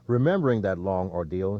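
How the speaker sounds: plain reading voice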